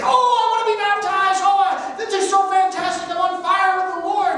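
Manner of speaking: high, fast gush of a new believer; excited enthusiasm. Acted. Teacher acting out an eager baptism candidate, gushing excitedly. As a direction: gush it loud and high, brimming with zeal. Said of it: "filled with excitement and enthusiasm and say".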